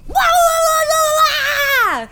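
very high wobbling whoop; overexcited wailing. Retold or imitated. Dad's body too excited, wild whooping. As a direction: let the excitement burst out as noise. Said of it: "He was just too excited".

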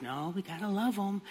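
soft, gentle chiding; lightly voiced cartoon angel; little angel. Angel on the shoulder calming an annoyed driver, gently. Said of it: "the angel on the other side".

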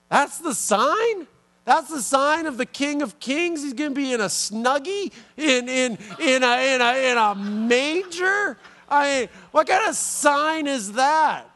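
can't believe this is the sign; incredulous disbelief. Acted. Preacher mocking the sign of a baby in a manger, disbelieving.

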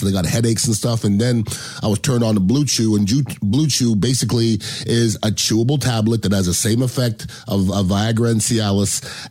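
host ad read dropped into the show; sponsor ad read. Acted. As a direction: read the sponsor spot smoothly.

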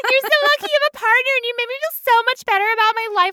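high, rushed fake-admiring voice; hammed-up inspirational pity; mock gushing. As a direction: gush condescendingly, high and fast.

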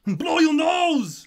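high, slow yelled Austrian command; Arnold-style yell. Retold or imitated. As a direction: bark it like the Terminator. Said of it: "I yell too much".

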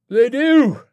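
loud, high, gleeful rocker yell; cheerful shout. A narrator's reading. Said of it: "he shouted cheerfully".

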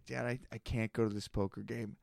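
retold quiet plea. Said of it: "and I'm like".

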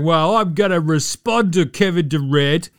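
slow, loud, whiny mock sob; tearful Skip. Mocking Skip Bayless's teary reply to Durant, hammed-up whine. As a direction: ham up a blubbering pundit. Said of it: "Skip is basically going".

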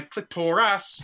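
clitoris as click-TOR-ass; misplaced stress. Acted. Siri praising her user, stressing clitoris wrongly as click-TOR-ass. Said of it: "put the emphasis in the right place".

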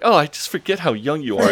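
high-pitched mock dismay; imitated dismay. Daisy apologising to young Will, mock dismay.

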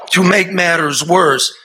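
rising anger. Real, not acted. Angry build.

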